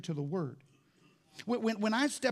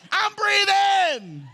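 high, drawn-out celebratory yell; freak-out shout; 12 semitones higher, 8 dB louder, much slower than usual. Acted. Dad waking the whole house, ecstatic drawn-out shout. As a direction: holler it long and loud. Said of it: "Freak your whole house out".

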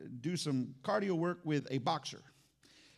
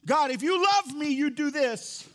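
higher, louder pleading to God; mock the begging prayer; whiny demanding prayer; 7 semitones higher, 5 dB louder than usual. Preacher mimics churchgoers demanding proof, louder and whiny. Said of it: "How many times we go".

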